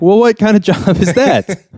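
high mock-baffled question breaking into laughter; laughing incredulity. Retelling dad's reaction to the dream, laughing incredulity. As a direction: crack up while playing the baffled dad.

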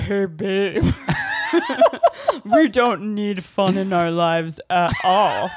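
loud, slow mocking voice; hammed-up killjoy partner, breaking into laughter; imitating partner's answer. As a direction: drag it out in teasing mimicry. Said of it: "Can I answer for you?".

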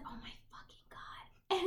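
slow, hushed mock-mortified whisper; acted horror. Imitating Laura hearing him tell her mom, horrified whisper. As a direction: whisper it slowly, as if appalled.

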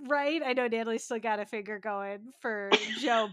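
ordinary talk

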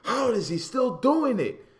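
mock commentator's loud, high amazement; astonished exclamation. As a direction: build to a stretched, high cry of disbelief. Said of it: "they could be like".

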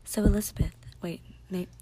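breathy ASMR whisper; mock ASMR host whisper. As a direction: whisper it close like an ASMR video. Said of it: "this is A S M R".

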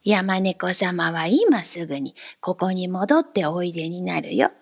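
put-on a strange little man smirking at the boy; grinning odd man. A narrator's reading. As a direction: speak low with a crooked grin. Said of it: "口を曲げてニヤッと笑って言いました".

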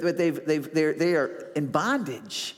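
normal talking voice

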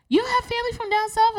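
high-pitched nosy question; imitated woman. Woman at the hospital asking about family, high imitated voice. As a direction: pitch it way up as a curious stranger. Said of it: "And she's like".

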